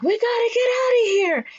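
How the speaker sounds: very high hammed-up escape cry; frantic high-pitched warning; urgent panic. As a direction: squeal it in mock panic. Voicing heroes fleeing collapsing city.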